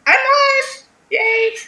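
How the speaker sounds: thrilled it finally worked; excited. Real, not acted. Delighted the stream finally works.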